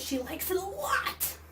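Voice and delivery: lot stretched and lifted high; slow, excited "a lot"; stress on lot. Shown as a demonstration. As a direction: read "she likes it a lot" with real excitement. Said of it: "There's an exclamation point".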